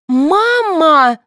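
high, drawn-out wail; screaming. A narrator's reading. Daughter panicking over the prize, screaming. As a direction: scream for mother, stretched out. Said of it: "завопила Соня".

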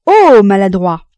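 loud, slow outcry; a young woman cries out at a clumsy catch; startled cry. A narrator's reading. She cries out at his clumsy catch, loud and drawn out. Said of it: "ne put retenir un cri".

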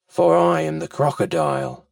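very low crocodile voice; deep reptile; crafty crocodile. Acted. Crocodile introduces himself to child.

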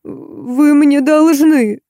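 suddenly younger voice. A narrator's reading. Faina suddenly attacking Marta, slow and demanding. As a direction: drop the whine and go on the attack. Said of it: "неожиданно сменив тон, отчего голос её помолодел".

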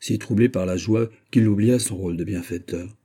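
usual speaking voice

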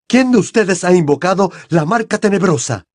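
high-pitched, furious interrogation; enraged accusation. A narrator's reading. Crouch accusing the children of the mark, enraged. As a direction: press them for the culprit. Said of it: "tenía el rostro crispado de rabia".

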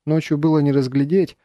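ordinary delivery

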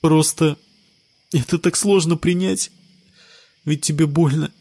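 holding back tears. Acted. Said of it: "сквозь набегающие слёзы произнесла Джессика".

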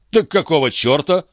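high, exasperated bark without much volume; high-pitched angry outburst. A narrator's reading. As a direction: order them out in irritation. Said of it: "рявкнул Жан".

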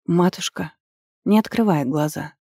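plain reading voice

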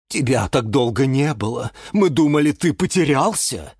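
lightly acted whimper; sobbing. A narrator's reading. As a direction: wail a little, as if near tears. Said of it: "всхлипнул гиацинт Василий".

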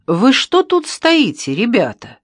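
high, loud, ringing question; slow, high-pitched ringing call. A narrator's reading. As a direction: call out clearly in surprise. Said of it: "Раздался вдруг звонкий голос".

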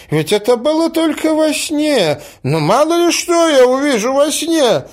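put-on very high-pitched old prince; crying out in protest. A narrator's reading. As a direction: protest helplessly at the top of the register. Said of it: "закричал князь". (вскричать высоко, оправдываясь)

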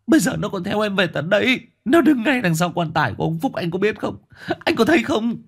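building to a high, far-off shriek; near screaming. A narrator's reading. Huy screaming at his boss that a ghost haunts him. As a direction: rise into a desperate scream. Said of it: "Huy nói như gào lên".

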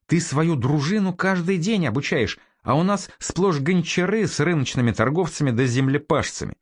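incredulous. A narrator's reading. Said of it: "недоверчиво спросил Фёдор Александрович".

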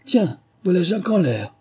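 high crowd shout; general cry. A narrator's reading. Crowd of animals crying out, high and loud. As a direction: cry out together. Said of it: "un cri général de".

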